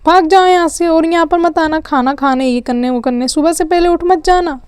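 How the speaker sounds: put-on mother scolds the kids out of the kitchen; angry yell. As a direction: drive them off with a shout. Mother shouting kids away.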